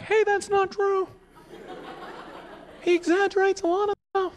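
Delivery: mock a wife calling from the back; heckling wife. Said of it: "She'd be sitting in the back".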